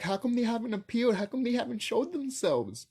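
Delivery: light mock of a skeptic video host; mocking question. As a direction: mimic her doubting tone. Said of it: "she's like".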